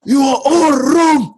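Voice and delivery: high, impassioned film-quote cry; quoted outburst. Retold or imitated. Host quoting T'Challa's challenge to elders, high mock outburst. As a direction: declaim it high, imitating the film.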